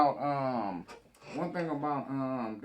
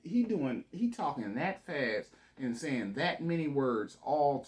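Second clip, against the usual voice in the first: loud interruption; 3 semitones higher, 10 dB louder than usual. Real, not acted. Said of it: "cutting off the filibuster".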